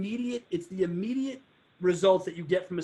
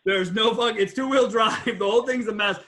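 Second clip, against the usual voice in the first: laughing; 3 semitones higher, 7 dB louder than usual. Real, not acted. Laughing at the car story.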